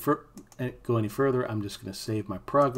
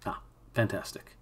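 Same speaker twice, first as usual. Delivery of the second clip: ordinary delivery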